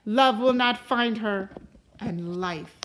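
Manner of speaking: loud threatening curse that sinks to a whisper at the ends; angry fairy's curse. Acted. Uninvited fairy cursing the king's baby, menacing and loud. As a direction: pronounce the doom, then hiss the last words. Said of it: "Then the angry fairy stood over the child, and she said".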